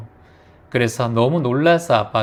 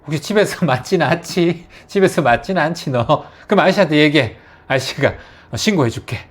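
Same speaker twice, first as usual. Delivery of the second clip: light joking; 4 semitones higher, 4 dB louder than usual. Shown as a demonstration. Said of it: "농담 삼아 한마디 살짝".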